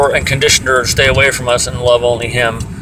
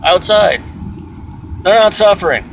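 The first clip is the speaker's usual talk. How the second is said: angry insistence; 7 semitones higher than usual. Real, not acted. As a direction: insist it, fed up. Angry rant about busybodies.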